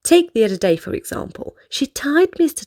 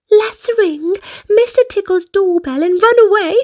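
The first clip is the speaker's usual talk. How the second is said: high, giggly scheming with a laugh in it; giggling; 8 semitones higher, 3 dB louder than usual. A narrator's reading. Miss Naughty giggling her prank plan to Mister Worry, gleeful. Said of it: "she giggled".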